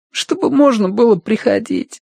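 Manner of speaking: high, pleading tearful voice; through tears. A narrator's reading. Boy wants to visit his cat's grave, through tears. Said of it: "сквозь слёзы выговорил Тенька".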